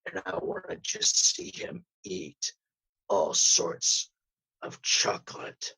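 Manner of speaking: a hint of a gruff, menacing growl; mock-tough lawman voice, lightly done; gruff intimidating judge. Host demoing the gruff voice he asked for. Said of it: "a voice that I told him I wanted to be like Carl Urban ... really gruff and he sounds really intimidating".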